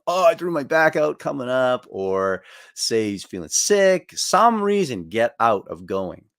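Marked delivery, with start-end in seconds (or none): 0.1-1.9 fake injury whine. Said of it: "would fake an injury".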